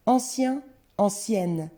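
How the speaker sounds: "Ancien" ends nasal, "ancienne" on a sounded n; teacher contrasting nasal "Ancien" with "ancienne"; changed pronunciation. Shown as a demonstration. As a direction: model "Ancien" rising, then "ancienne" falling with clear n. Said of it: "ça change la prononciation".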